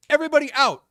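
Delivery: high, loud announcement; shouted order. Retold or imitated. Staff closing the ballroom bar, shouted order. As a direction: call it out to clear a whole room.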